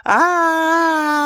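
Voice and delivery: put-on high piercing child's scream; long scream. Child screaming after being struck. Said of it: "I let out a long scream".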